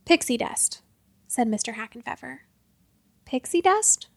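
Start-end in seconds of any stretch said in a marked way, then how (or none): 3.2-4.1 higher, puzzled question rising at the end; puzzled echo. Acted. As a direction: repeat it back, baffled. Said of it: "said Quincy".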